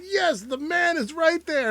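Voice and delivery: high, slow and drawn out; slow mock Travolta. Host voices Travolta pointing out the man, high and slow. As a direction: point him out grandly. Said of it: "He's like".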